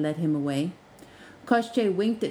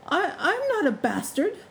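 high, stammering protest; a flustered freshman objecting, pitched well up; protesting student; 11 semitones higher than usual. A narrator's reading. Freshman protests to the porter, flustered and high. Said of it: "the brighter ones would protest".

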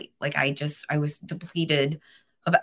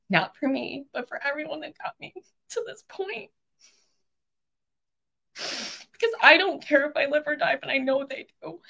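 emotional; 10 semitones higher than usual. Real, not acted. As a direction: voice high and choked with real tears. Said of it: "I get emotional".

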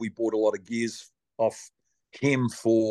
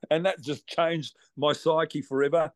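ordinary delivery

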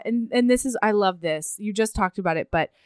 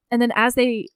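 ordinary talk, nothing marked

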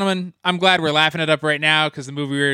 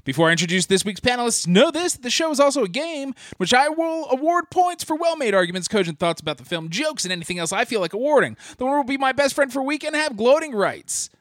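big booming show host intro; announcer voice; 6 semitones higher than usual. Acted. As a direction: pitch it high like a game-show announcer. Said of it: "Was that, like, your announcer voice?".

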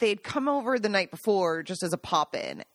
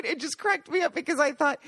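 ordinary delivery

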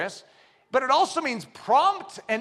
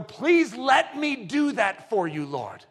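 eager hilaros giver; 8 semitones higher than usual. Retold or imitated. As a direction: beg God eagerly to let you give. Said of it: "I'm a hilaros giver".

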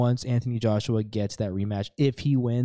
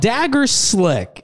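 "Dagger slick" much higher, louder and very slow; drawn-out shout; 11 semitones higher, 7 dB louder, much slower than usual. Shown as a demonstration. Cohost savours the ad's phrase "Dagger slick", shouted long and high. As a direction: belt out "Dagger slick" stretched long and loud. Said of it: "put the word dagger slick".

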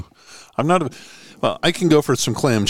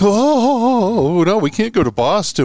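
higher, whining put-on moan of complainers; mock whiny complaint; 7 semitones higher than usual. Mocking eastern hockey teams who refuse Boston, whiny mock moan. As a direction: tease the whiners with a hammed-up wail. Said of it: "And then they're like".

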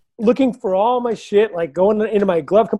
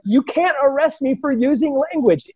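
higher-pitched, argumentative retort; indignant protest; 7 semitones higher than usual. Retold or imitated. Driver arguing back at a cop, indignant. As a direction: retell it as an outraged comeback.

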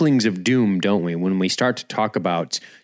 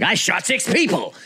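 mock boasting; 7 semitones higher than usual. Mimicking boastful guys.

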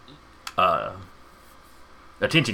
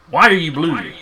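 mad professor; 5 semitones higher, 7 dB louder than usual. Acted. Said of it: "ranting and raving like a madman".

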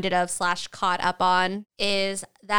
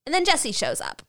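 ordinary delivery

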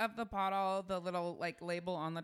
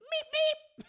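squeaky cartoon horn meep; high meep meep; 7 dB louder than usual. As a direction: chirp it like a tiny horn. Makes a vehicle sound.

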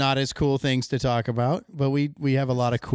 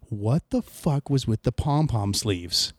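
ordinary delivery

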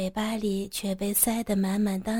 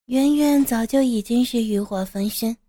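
ordinary delivery, nothing marked

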